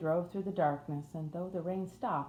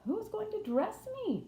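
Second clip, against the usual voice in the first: demanding. A narrator's reading. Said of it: "demanded Mary".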